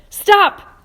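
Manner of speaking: very loud, high cry; cried out. A narrator's reading. Earthworm cries out in panic to stop James, very loud. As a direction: scream it to stop him. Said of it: "cried the earthworm".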